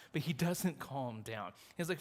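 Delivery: usual speaking voice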